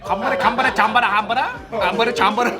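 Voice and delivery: pretend Korean. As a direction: fake Korean gibberish, laughing. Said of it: "This fool's gonna pretend Korean".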